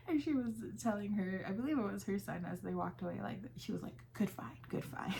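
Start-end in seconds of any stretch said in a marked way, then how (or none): 4.1-5.1 hushed whisper to her son; whispered aside. Retold or imitated. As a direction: murmur it under your breath, pleased.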